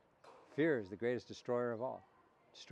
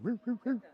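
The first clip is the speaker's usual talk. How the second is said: whimpering dog; 8 semitones higher than usual. Retold or imitated.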